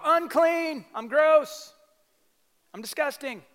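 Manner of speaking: leper's yell. Retold or imitated. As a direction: shout the warning like an outcast. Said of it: "they had to yell".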